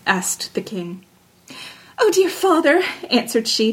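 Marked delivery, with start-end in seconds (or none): 2.0-2.8 high, appealing princess voice; upset princess, pitched high; distressed princess. A narrator's reading. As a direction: appeal to father, voice lifted high. Princess appeals to her father.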